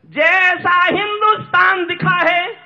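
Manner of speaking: high, loud, slow declamation; ringing drawn-out recital; impassioned verse. Acted. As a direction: proclaim the line, stretching it. Climactic line after a quiet aside.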